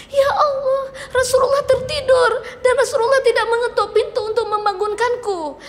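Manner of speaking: high, tearful voice of Aisyah; tearful awe. Acted. Aisyah finding the Prophet asleep at the door, high and tearful. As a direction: cry out, touched to tears.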